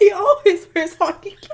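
loud, high laughing reply; blurted through laughter. Real, not acted.

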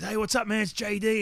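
high, pumped-up greeting in imitation; high-energy producer. Producer cold-calls about the show, high-energy impression. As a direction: impersonate an over-energetic TV producer. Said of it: "energy through the roof".

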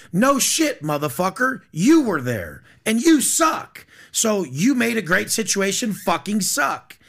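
exasperated scolding, punching the swear words; heated rant. Real, not acted. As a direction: lay into them, fired up. Ranting at rich unhappy people.

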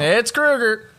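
loud, high-pitched insistence; insistent correction. Real, not acted. Co-host insisting on a villain's proper name, loud correction.

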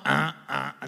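short low honks; imitated goose honks. Gosling hears only the mother, low honks. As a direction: drop low and honk like a goose. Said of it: "no sea la mamá".

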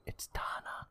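eerie hushed whisper. Retold or imitated. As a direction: breathe it out like a secret. Sister passes spooky phone call.

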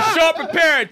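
high, loud shout. Real, not acted. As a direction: yell it across the room. Shouted repeat.

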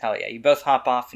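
usual speaking voice

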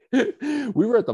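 laughing through the words, slow. Retold or imitated. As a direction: retell the aunt laughing at you. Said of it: "she started laughing at me".

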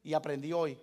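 usual speaking voice

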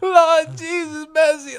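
very high, loud mock sobbing cry; hammed-up lament, slow and high; imitated loud wailing. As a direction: wail like an overwrought funeral mourner. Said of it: "Like, loud".

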